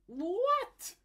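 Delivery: very high, loud squeak of disbelief; mock surprise. Artist reacting to the program crashing, shocked squeak. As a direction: yelp like caught off guard.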